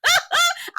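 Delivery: high cackling laugh; real laugh. Real, not acted. Laughing at Mel's reaction, high cackle.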